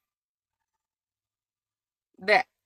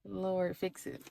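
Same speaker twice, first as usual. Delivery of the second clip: mock prayer; 10 dB quieter, slower than usual. Real, not acted. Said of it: "my little edges is".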